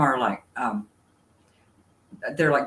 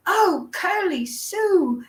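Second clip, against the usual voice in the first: slow, much higher imitation of an English accent; British accent; 4 dB louder, much slower than usual. Imitating the English neighbor comforting a derby girl, English accent. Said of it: "with his accent".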